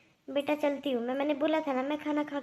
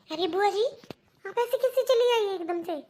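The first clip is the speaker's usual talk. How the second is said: higher and louder, alarmed; worried surprise; 5 semitones higher, 4 dB louder than usual. Acted. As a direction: call after her, startled she left. Niece runs after leaving aunt.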